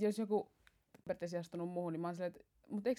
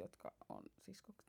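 whisper; 19 dB quieter than usual. Real, not acted. Word trails off unvoiced.

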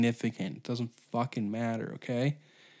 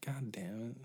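muttered aside; 7 dB quieter than usual. Retold or imitated. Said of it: "He's like".

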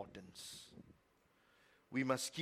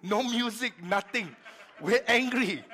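laughing; 7 semitones higher, 10 dB louder than usual. Real, not acted. Laughing about tense car ride.

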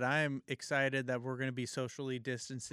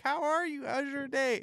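much higher, sugary polite voice; sweet mock politeness; 13 semitones higher, 4 dB louder than usual. Co-host imagining Kobe to his wife, high sugary mock politeness. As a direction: chirp it like an overly sweet husband.

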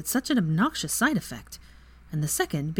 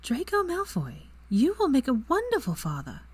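earnest reassurance; 8 semitones higher than usual. A narrator's reading. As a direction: insist on it tenderly.

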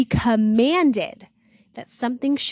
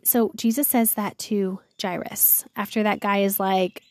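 usual speaking voice

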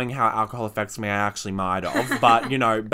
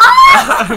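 high, loud helpless laugh; telling her friends the prank story, she bursts out laughing; burst of laughter; 16 dB louder than usual. Real, not acted. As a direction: crack up out loud. Laugh again.

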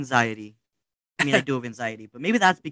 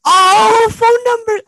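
shrieked realization; 8 dB louder, much slower than usual. Real, not acted.

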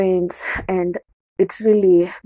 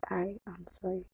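much softer, almost breathless sorry; hushed apology; 16 dB quieter than usual. Retold or imitated. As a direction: barely say sorry. Retold apology to friend's mother.

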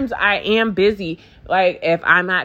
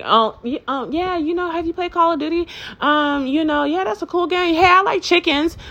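mock random chatter; 7 semitones higher than usual. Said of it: "you random".

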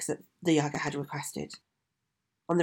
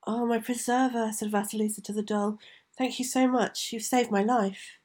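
put-on lightly raised, tender young girl; grateful young girl voice; 6 semitones higher than usual. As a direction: thank her like a grateful child. Vasilisa thanking the doll.

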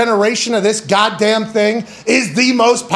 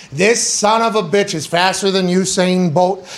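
ordinary delivery

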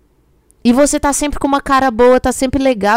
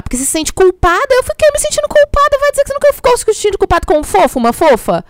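much higher, fast mock whine about feeling guilty; mocking whiny voice; 10 semitones higher, faster than usual. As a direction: parody a guilt-ridden sweetie. Mocking guilty partner excuse.

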